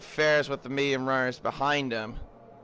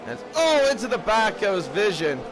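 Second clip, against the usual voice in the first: oh jumps high and loud; excited outburst; 7 semitones higher, 4 dB louder than usual. Real, not acted. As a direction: react to the crash live. Sudden crash in race.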